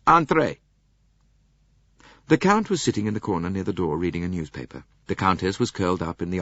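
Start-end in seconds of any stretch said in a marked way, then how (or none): 0.1-0.6 1 called out. Acted. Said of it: "A voice from within cried".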